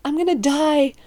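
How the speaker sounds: high, agitated retold panic; re-enacted nervous freak-out. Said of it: "freaking out, and I'm just like".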